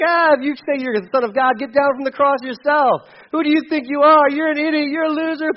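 mocking taunt. Condemned thief jeering at Jesus from the cross, mocking. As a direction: sneer it out like a scornful criminal. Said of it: "started yelling at Jesus too".

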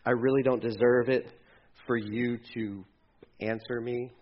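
low, subdued, self-doubting plea; unworthy prayer. Acted. Believer talking to God in a desperate spot, low and defeated. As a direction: confess softly as one expecting no answer. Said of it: "when we're at that spot".

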